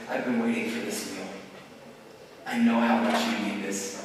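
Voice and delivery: low, soft and loving; tender Jesus. Acted. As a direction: speak softly to friends who will fail you. Jesus speaks to failing disciples.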